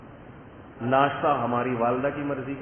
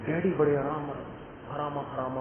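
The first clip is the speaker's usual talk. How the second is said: soothing father; 5 dB quieter than usual. Retold or imitated. Father calming the angry son.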